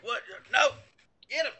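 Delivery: mock startled, high-pitched exclamations; acted alarmed outcry. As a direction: act out a quick, startled order. Game master acts out startled reaction.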